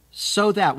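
stressing "so that" for the listeners; emphatic repeat. Acted. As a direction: punch "so that" again. Repeats key phrase forcefully.

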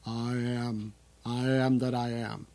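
a hint of a deep, booming movie God voice; mild imitation of a grand, solemn screen God; Charlton Heston's God. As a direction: lightly intone it like the voice of God. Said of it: "I have to say it like that".

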